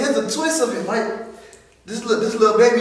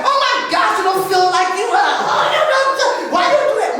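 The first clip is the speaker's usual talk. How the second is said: put-on mom attacking girl; 13 semitones higher than usual. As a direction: shriek like his mom in the middle of a fight. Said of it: "It's like".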